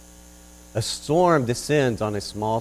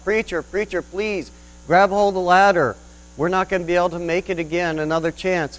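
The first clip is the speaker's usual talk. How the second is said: higher, louder yell over the rotor; yelling from helicopter; 5 semitones higher, 4 dB louder than usual. Acted. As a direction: shout down urgently from a helicopter. They yell out.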